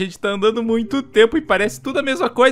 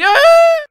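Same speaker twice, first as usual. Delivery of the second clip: a high, much louder yelp of shock; startled scream; 10 dB louder than usual. Acted. Steve starts falling off the cliff, high shocked scream. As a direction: cry out as the fall begins.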